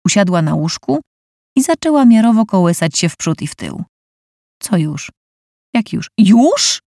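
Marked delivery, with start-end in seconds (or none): 4.6-6.8 rising panicked questions. Acted. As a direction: lose your head, panicking. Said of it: "spanikował Robert Kozak".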